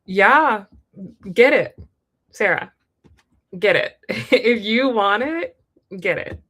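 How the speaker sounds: loud, bright hype for a friend; excited cheer. Real, not acted. Reader cheering Sarah on to go for the rich guy, loud and excited.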